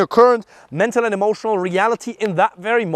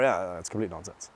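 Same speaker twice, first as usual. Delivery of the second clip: offhand dismissal; 10 semitones lower, 10 dB quieter than usual. Shown as a demonstration. Demo disagreeing line.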